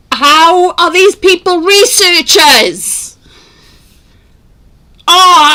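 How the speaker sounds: loud, high, slow outraged question; wound-up exasperation. Real, not acted. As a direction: drag it out in exasperated disbelief. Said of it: "This has wound me up".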